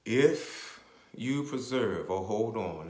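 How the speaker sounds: slow, deliberate, each word spaced; said slowly. Shown as a demonstration. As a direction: spell it out slowly for the listeners. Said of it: "let me say it slowly".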